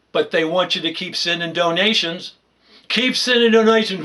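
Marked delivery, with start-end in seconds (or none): 2.9-4.0 higher, louder sarcastic charity pitch; mocking plea. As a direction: parody the doctors begging for cash. Mocking doctors asking for money.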